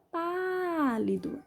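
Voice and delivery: "pálido" with PÁ stretched long and high; stress on the first syllable. Shown as a demonstration. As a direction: draw out the PÁ in "pálido". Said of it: "pronunciada com mais força".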